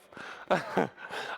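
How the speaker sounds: breathy, voiceless laugh; brief light laugh; short chuckle. Real, not acted. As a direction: chuckle at your own joke. Joke about his wife.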